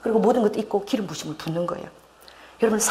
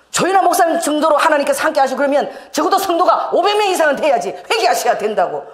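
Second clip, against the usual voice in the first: higher and louder, laughing through the scolding; laughing imitation of a cross pastor; mock angry pastor; 7 semitones higher, 5 dB louder than usual. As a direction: retell his rebuke with amused mock anger. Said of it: "막 저에게 화를 내시는 거야".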